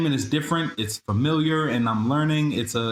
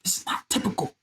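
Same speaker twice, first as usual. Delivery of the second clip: a soft whispered aside, higher; stage whisper; 6 semitones higher, 4 dB quieter than usual. Acted. Host teasing listeners about his location, whispered aside. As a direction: lean in and whisper it like a secret. Teasing reveal he's abroad.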